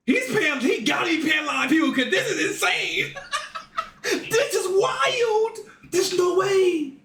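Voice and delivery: can't believe it, laughing it out; cracking up in shocked disbelief; laughing shout. Real, not acted.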